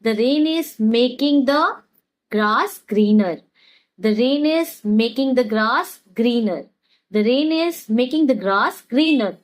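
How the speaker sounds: very slow, word by word for learners; slow teaching demo. Shown as a demonstration. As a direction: spell it out slowly so students can repeat. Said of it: "इसे हम कैसे कहेंगे?". (धीमे-धीमे सिखाते हुए)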